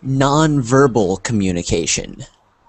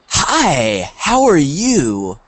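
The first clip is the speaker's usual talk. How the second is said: drawn-out greeting with big swooping intonation; excited, happy; 2 semitones higher, much slower than usual. Shown as a demonstration. Teacher showing students how intonation changes meaning, excited. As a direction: greet them with exaggerated, lingering delight. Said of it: "What is the difference?".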